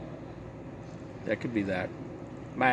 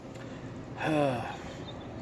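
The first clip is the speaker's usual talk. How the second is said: sigh; 9 dB quieter than usual. Real, not acted. Sigh alone on line.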